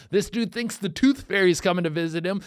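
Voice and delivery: ordinary talk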